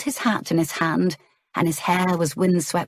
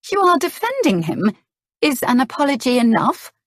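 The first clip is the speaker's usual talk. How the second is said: high, louder indignant question; indignant challenge; 7 semitones higher, 4 dB louder than usual. A narrator's reading. Clarissa challenges her husband, indignant and high. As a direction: push back at him in disbelief.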